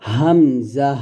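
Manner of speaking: হামজা light from the top of the throat; Arabic letter demo. Shown as a demonstration. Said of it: "আমার সাথে আপনারা আবারও পড়েন". (গলার শুরু থেকে হামজা)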